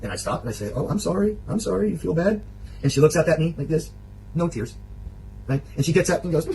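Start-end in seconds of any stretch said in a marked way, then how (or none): 0.7-2.4 higher-pitched, placating mock apology; acted apologetic concern. Man replays apologising to pouting partner, acted concern. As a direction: lift the voice into exaggerated sympathy.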